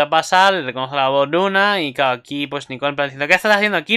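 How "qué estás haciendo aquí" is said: higher, louder confrontational question. Acted. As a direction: challenge them with a raised voice. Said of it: "está Nicole ofensiva".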